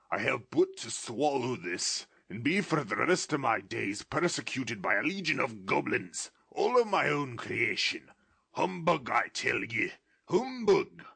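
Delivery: put-on high, loud scoffing old miser; Scrooge's voice. Scrooge scoffing at the ghost, loud and high. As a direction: bluster away the fear.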